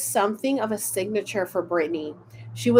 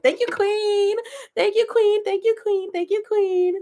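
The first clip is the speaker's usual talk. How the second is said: excited thanks; 11 semitones higher than usual. Real, not acted. Thanking the card, playful.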